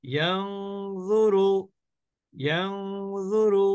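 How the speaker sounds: ইয়ানজুরু with a sustained "ng" hum before "zuru"; ghunnah demo. Shown as a demonstration. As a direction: slowly intone ইয়ানজুরু, holding the nasal. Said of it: "অনিশ্বারের মতন করে গুণনা করছি".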